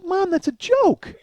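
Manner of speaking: very high, whiny-comic protest; exasperated protest. Retold or imitated. Son tells mom it's a joke, high exasperated protest. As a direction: retell it as a squealed objection.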